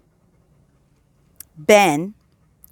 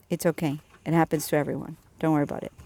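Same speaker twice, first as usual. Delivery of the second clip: reassuring; 4 semitones lower, 7 dB quieter, much faster than usual. Retold or imitated. Comforting embarrassed coworker.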